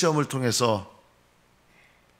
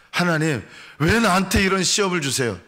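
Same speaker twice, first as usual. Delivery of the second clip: complaining to God; 5 semitones higher than usual. Retold or imitated. Preacher mimicking a believer who complains to God, aggrieved. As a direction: whine at God like a wronged believer. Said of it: "이렇게 하지 마십시오".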